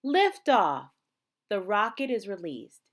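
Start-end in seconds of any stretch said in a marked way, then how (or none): 0.0-0.9 loud, higher, thrilled call; excited liftoff. A narrator's reading. Reading to children, exclaiming the liftoff excitedly. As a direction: announce the launch with a burst. Rocket launches in story.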